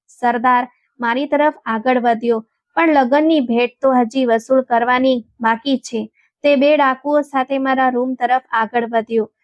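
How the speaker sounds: ordinary delivery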